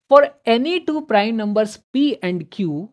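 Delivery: "For any two prime numbers P and Q" को बहुत धीमे, ज़ोर से, शब्द-शब्द पढ़ो; slow question reading. Shown as a demonstration. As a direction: read it word by word, slow and loud. Reads question aloud slowly. (प्रश्न को धीरे-धीरे ज़ोर से पढ़ना)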